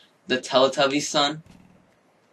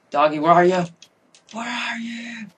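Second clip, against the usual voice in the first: calling out; 9 semitones higher than usual. Real, not acted. Gamer searching for his lost dog, high calling. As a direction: call the lost dog anxiously.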